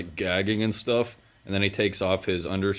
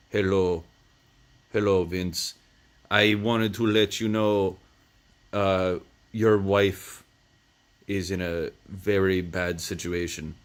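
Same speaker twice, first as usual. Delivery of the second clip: lightly voiced creepy European villain, slow and smooth; a hint of a sinister foreigner; creepy European man. Retelling the villain who threatens Vince, lightly creepy. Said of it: "It's, like, this creepy European man".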